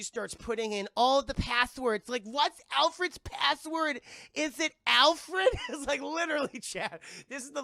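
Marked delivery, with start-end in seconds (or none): 2.2-5.5 high, loud, drawn-out questioning; hammed-up hacker puzzling out loud; slow, loud mock urgency; frantic password guessing.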